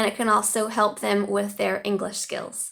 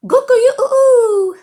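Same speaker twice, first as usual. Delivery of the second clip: much higher and louder, a drawn-out crow; Chinese rooster crow; 13 semitones higher, 10 dB louder than usual. Shown as a demonstration. Teacher trying the Chinese rooster call, hammed-up and loud. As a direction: belt the rooster call like a farmyard bird. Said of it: "they say".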